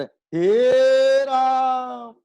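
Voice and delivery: हे stretched very long and high, loud; prolated vowel. Shown as a demonstration. Teacher showing the prolated vowel, a long ringing call. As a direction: hold हे on and on like a call. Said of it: "ये हो गया प्लुत".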